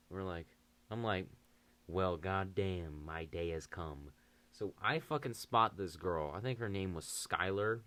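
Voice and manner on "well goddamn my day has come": mock dramatic. Savoring his chance, slow.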